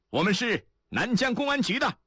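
high-pitched identifying call; calling back. A narrator's reading. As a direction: announce yourself across a distance. Identifying themselves to someone in the ditch. (高声回应，自报身份)

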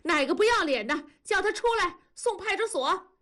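an angry woman demanding the culprit be hauled off; scolding. A narrator's reading. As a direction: rattle off the scolding, outraged. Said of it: "文丽跟着数了".

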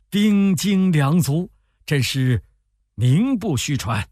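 high, loud, emphatic praise; admiring praise. A narrator's reading. Guest praising the host's troops and stores, admiring exclamation. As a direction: stretch it out in open admiration.

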